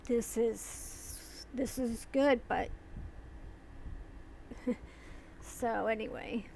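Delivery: usual speaking voice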